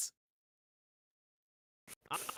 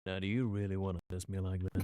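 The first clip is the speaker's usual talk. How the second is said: put-on cowboy accent; 10 semitones lower, 5 dB quieter than usual. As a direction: slip into a quiet, low cowboy accent. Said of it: "a country western accent or a cowboy accent".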